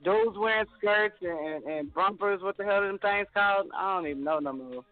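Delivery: ordinary delivery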